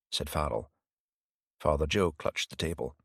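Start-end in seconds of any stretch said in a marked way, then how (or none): none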